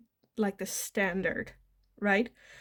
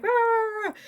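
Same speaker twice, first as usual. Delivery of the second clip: mock chihuahua bark; 12 semitones higher, 4 dB louder than usual. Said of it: "like, barking".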